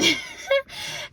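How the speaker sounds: a bright burst of laughter, high up; high self-mocking laugh; sudden high laugh. Real, not acted. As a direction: catch yourself and laugh out loud. Laughs at her own prediction.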